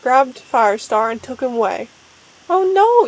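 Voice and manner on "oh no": raised, higher alarmed cry; yell. A narrator's reading. Starkit crying out to save him, a mild yell. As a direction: call it out in alarm. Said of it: "yelled Starkit".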